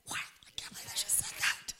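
soft unvoiced gasp of shock; shocked whisper. Believer catching their own prayer, in a shocked whisper. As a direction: whisper it, horrified at yourself. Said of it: "you'll go".

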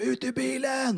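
high-pitched, drawn-out shouted order; mimicked police yell. Police order a drinking man out, mock yelling. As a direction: imitate police yelling a command, pitch jumping up. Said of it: "då skriker de".

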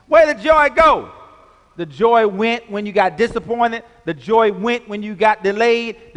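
loud, high-pitched pulpit fervour; full-force preaching. Real, not acted. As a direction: call it out forcefully to the congregation.

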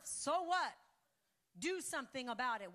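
sharp, loud challenge; loud rebuttal. Acted. Pastor challenging doubters in the church, loud rebuttal. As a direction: snap back at the doubters.

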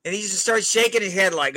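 normal talking voice

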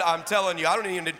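ordinary delivery

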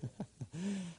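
a short soft laugh of joy; a brief happy chuckle. Real, not acted. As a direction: laugh quietly with delight. Laugh after the declaration.

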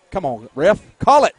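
shouting at the ref to call it; loud urgent plea to the referee; pleading with the referee. Real, not acted. Calling to the referee.

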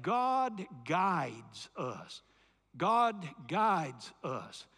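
God guides us, slow and weighty; drawn-out God guides us; slow emphatic phrase. Shown as a demonstration. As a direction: spell it out slowly for the congregation to repeat. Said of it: "Say that with me".

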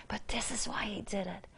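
hushed whisper for the key point. Acted. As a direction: lean in and whisper it. Drops to whisper mid-sermon.

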